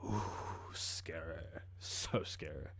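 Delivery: deep, soft, sarcastic "Ooh, scary"; mock being scared in a low murmur; sarcastic mock fear. Scoffing at the attackers' slogan, sarcastic low mock fear. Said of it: "sarcastic mock fear".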